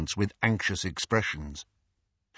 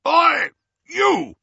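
loud, high shout up the stairs; angry uncle yelling up the stairs; 8 dB louder than usual. A narrator's reading. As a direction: bellow it from downstairs. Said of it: "a voice yelled".

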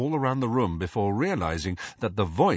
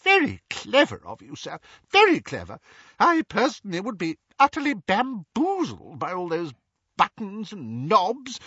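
high, eager little wizard voice; a chirpy, over-polite wizard explaining the plan; Daedalus's high, excitable voice; fussy wizard Daedalus; 11 semitones higher, 3 dB louder than usual. A narrator's reading.

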